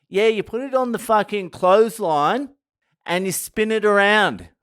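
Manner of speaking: lightly hammed-up boastful explanation; imitating the Aussies. Aussie tourists telling the tour guide about goon of fortune, mocking. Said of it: "They're like".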